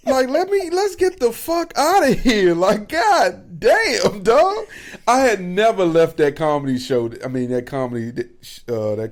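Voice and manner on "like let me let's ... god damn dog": much higher, louder, slowed pleading; desperate urging. Retold or imitated. Begging to leave the comedy show, high exasperated plea. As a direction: beg to get out of there.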